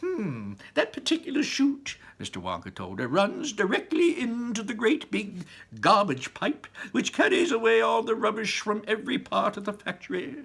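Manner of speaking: high, breezy showman explaining; cheerful Wonka. Acted. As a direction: tell her cheerfully about the garbage.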